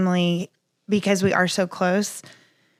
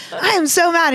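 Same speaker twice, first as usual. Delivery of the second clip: mock mad; 9 semitones higher than usual. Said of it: "And I can be like".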